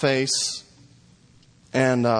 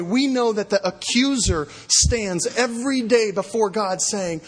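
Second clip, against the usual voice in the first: preaching at full force; 8 semitones higher than usual. Acted. Pastor urging the congregation, preaching at full force. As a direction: drive the point home to the church.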